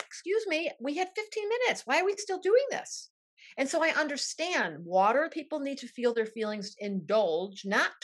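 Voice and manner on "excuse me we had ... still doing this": much higher, exasperated mock voice; higher, impatient, pretend earth person; impatient mock Capricorn. Earth-sign daughter cutting off feelings in a much higher, exasperated voice. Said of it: "You're like".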